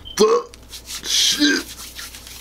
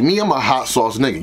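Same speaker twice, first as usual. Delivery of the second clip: usual speaking voice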